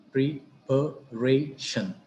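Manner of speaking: "preparation" slowly split as pre, pa, ray, shun; syllable by syllable. Shown as a demonstration. Teacher dividing an example word, "preparation" slowly in separate syllables. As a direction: pause between each syllable of "preparation". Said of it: "The same is the case".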